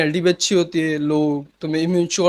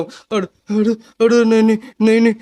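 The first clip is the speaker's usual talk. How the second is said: mock cowering plea; 4 dB louder than usual. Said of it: "ऐसे वाला भी नहीं कह रहा".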